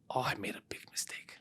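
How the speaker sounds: whispered regret; imagined whisper. Retold or imitated. Voicing the startled driver's inner thoughts, hushed whisper. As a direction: breathe it out like a scared thought. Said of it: "I know that they're saying".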